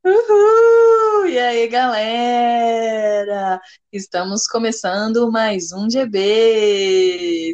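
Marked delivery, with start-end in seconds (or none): 0.0-3.6 excited cheer. Real, not acted. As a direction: cheer the crowd in. Co-host cheering to open the episode.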